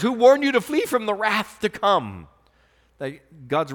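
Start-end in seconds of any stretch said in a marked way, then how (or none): 0.0-2.3 loud, high warning of the wrath; preached warning. Acted. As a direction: cry it out over the crowd. John's rebuke, shouted out.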